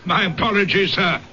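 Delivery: normal talking voice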